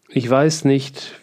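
ordinary delivery

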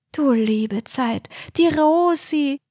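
put-on slow, breathed, high old lady; breathy dismay. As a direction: sigh it out in shock. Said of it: "hauchte Isolde betroffen". (betroffen gehaucht, langsam)

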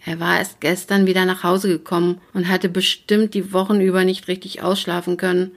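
ordinary delivery

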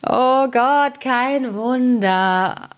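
high, strained and very slow. Real, not acted. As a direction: voice straining after a long read. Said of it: "meine Stimme versagt".

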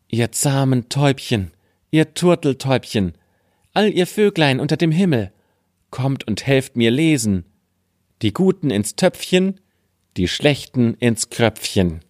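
high, pleading call to the birds; sing-song call, rising high. Acted. (hoher, flehender Ruf an die Vögel)

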